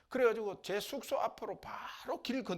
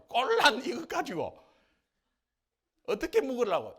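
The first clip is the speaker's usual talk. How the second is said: louder complaining Gyeongsang dialect; Gyeongsang grumble; 6 semitones higher, 11 dB louder than usual. Retold or imitated. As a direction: grouse in Gyeongsang dialect about the scraps. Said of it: "경상도 말로".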